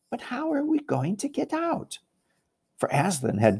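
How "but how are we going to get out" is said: much higher, light fawn voice; Tumnus's worried question. Acted. Fawn Tumnus asks the freed statues, high-voiced. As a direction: pitch it up high and soft as the fawn. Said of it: "someone, Tumnus, I think, first said".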